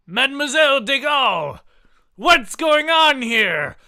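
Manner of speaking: hammed-up outraged yell; de Gaulle finds assassins, mock shouting; mock alarmed shout. De Gaulle alarmed, voiced in story.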